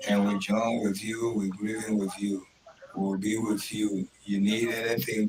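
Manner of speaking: usual speaking voice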